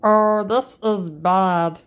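ordinary delivery

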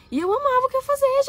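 high-pitched gush of passion. Real, not acted. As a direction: burst out with heartfelt enthusiasm. Exclaiming love for old work.

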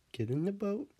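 high, lilting rise to the end; coaxing. Real, not acted. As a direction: coax a pet in a high, rising voice. Coaxing her dog into boat.

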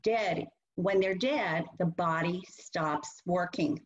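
put-on slow, plain words for a small child; slow simple explanation. Shown as a demonstration. Teacher showing parents how to explain death, slow and simple. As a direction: spell it out slowly and gently. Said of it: "So you need to say to them".

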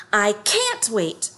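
impatient tiger itching to pounce; seventh tiger. A narrator's reading. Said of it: "said the seventh tiger".

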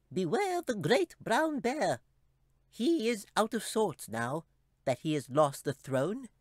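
very high, slow, solemn squeak; tiny creature warning gravely; ominous squeaky chipmunk. A narrator's reading.

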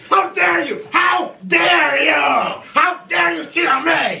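loud, slow, high furious shouting; shaking with anger. Pretend. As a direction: scream it like a scorned lover. Mock jealous rage, food fight.

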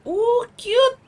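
very high delighted squeal; squealing excited. Real, not acted. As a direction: squeal it like it's adorable. Reacting to the gift.

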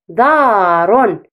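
put-on دار stretched out very slowly for children; slow modelled word. Shown as a demonstration. Teacher models the word slowly for children to repeat. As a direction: draw out دار slowly, syllable by syllable. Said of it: "رددوا".

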